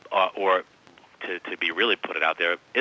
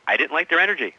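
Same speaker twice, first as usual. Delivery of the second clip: repeated louder and higher for emphasis; 8 semitones higher, 6 dB louder than usual. Retold or imitated. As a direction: say it again so she hears, higher and louder.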